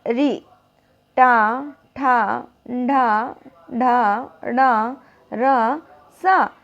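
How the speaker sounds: री, टा, ठा with the tongue curled back; retroflex drill. Shown as a demonstration. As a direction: curl the tongue for each syllable. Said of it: "इसको बोलिए".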